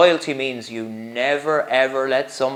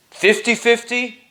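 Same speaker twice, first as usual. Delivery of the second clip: "Fifty fifty" stated firmly with a falling close; confirming; 7 semitones higher, 4 dB louder than usual. Acted. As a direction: assure him it's true. Master confirming the offer.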